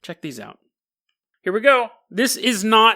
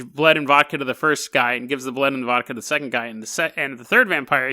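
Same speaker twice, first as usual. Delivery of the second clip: usual speaking voice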